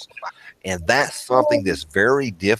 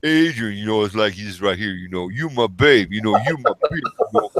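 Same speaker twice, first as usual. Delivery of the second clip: raised, hammed-up New York street voice; New York accent; 3 semitones higher than usual. Shown as a demonstration. Friend demonstrating New York talk with "Yo, Adrian", exaggerated New York accent. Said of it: "like New York accent".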